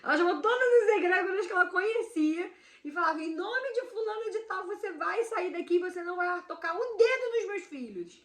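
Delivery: high, loud commanding cry; mother shouting to drive the entity out; mock shouted invocation. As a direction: banish the spirit at full voice.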